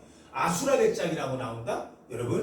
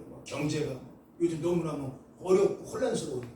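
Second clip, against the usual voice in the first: ordinary delivery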